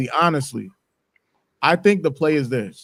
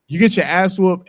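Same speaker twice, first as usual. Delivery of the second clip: ordinary talk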